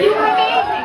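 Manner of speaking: high, loud fake praise; pretend gushing. Comic shows audience how to lie, pretend gushing. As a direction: gush like a fan who's lying. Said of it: "like, pretend".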